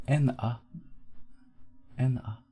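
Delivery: ordinary delivery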